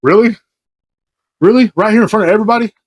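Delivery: high-pitched mock disbelief, rising questions. Storyteller reacting to a deal in plain view, disbelief. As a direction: ask it high, as if you can't believe it.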